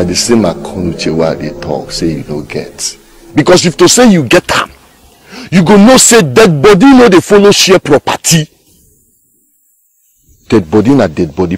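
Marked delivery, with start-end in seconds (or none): 3.3-8.5 loud, high-pitched indignation; exasperated high yelling; heated argument. Acted. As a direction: shout the point at him, voice way up. Arguing over the share.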